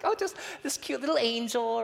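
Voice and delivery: very high sweet "oh, hi"; cutesy little girl. Retold or imitated. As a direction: switch to an adorable child. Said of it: "Just like".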